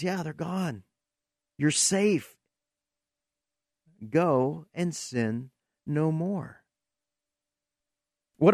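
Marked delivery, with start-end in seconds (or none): 0.0-6.6 tender comfort. Acted. Jesus comforts the weeping woman.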